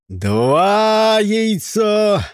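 loud, high count; shouting. A narrator's reading. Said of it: "крикнул Джон".

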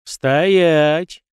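a hint of a lazy guard's order; lordly command. A narrator's reading. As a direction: bark it out lazily, sure of yourself. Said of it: "вальяжно распорядился тот".

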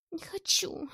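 high, breathless struggling cry; coughing cry. Acted. Girl struggling against the glass, breathless high cry. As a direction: cry out while choking. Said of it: "кашляла и, задыхаясь, кричала Маша".

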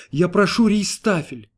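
high, drawn-out plea; desperate customer's demand; near-tearful cry. A narrator's reading. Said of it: "закричал он, едва не рыдая".

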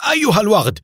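loud, high-pitched outraged shout; feigned angry shout. A narrator's reading. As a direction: yell like a wronged husband. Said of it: "وهو يصيح متظاهرا بالغضب".

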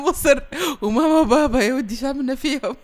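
high, loud, amused outburst; tickled, mock-indignant; laughing protest. Real, not acted. Host laughs at child's answer.

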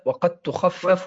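تخفف drawn out slowly for emphasis; a teacher slowly repeating وقد تخفف. Shown as a demonstration.

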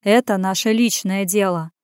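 plain reading voice

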